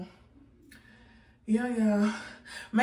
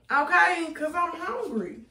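ordinary delivery

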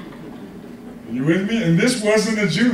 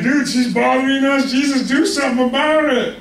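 higher-pitched, mock-exasperated grumble; exasperated complaint; 6 semitones higher than usual. Disciples complain to Jesus about a pestering woman, exasperated. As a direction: imitate fed-up followers pleading for action.

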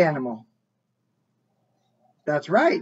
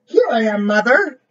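louder call up to the plane; 3 semitones higher, 7 dB louder than usual. A narrator's reading. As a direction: call up into the sky. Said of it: "he called out".

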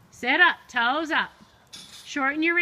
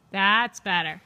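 ordinary talk, nothing marked

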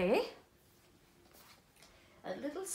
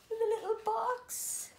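delighted gasp; 11 semitones higher than usual. Real, not acted. Spotting the little box.

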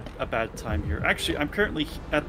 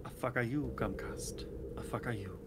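muttered cursing; 11 dB quieter than usual. Real, not acted. Player blaming Comcast for lag, muttered cursing. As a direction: curse softly under the breath.

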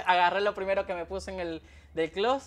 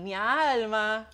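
slow Zulian "mi alma"; 4 semitones higher, much slower than usual. Retold or imitated. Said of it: "te sale un".

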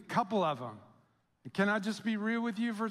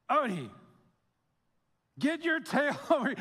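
pleading call; 5 semitones higher than usual. Retold or imitated. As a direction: beg the dog to come back, half laughing.

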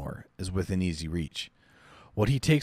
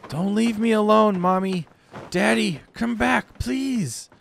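put-on high, louder child voice crying out; desperate little girl; 13 semitones higher, 6 dB louder than usual. Girl calling after her parents, high desperate child voice. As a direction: beg them not to leave.

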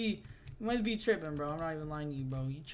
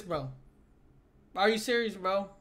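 usual speaking voice

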